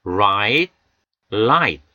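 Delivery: right with curled tongue, light with tongue tapping; r versus l contrast. Shown as a demonstration. Teacher contrasting right and light for students. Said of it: "R 사운드와 L 사운드를 구별해 보겠습니다".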